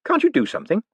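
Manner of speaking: put-on high, outraged young man; blurting a defence; indignant protest. Moist pleads.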